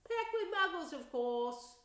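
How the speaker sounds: put-on high, loud, busy mother talking to her boys; bright fussing mum; bustling mother voice. Plump mother chatting to her sons, high and loud. Woman passing Harry in station.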